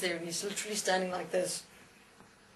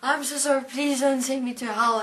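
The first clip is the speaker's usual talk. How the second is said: higher, louder imitation of tearful begging; mock crying plea; 6 semitones higher, 5 dB louder than usual. Atheist fallen on the stairs begging the angel, mock-tearful. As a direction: act out a wailing plea for mercy. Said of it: "he starts crying, he says".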